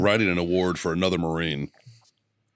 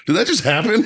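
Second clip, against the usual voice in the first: stunned marines; 11 semitones higher, 4 dB louder than usual. Retold or imitated.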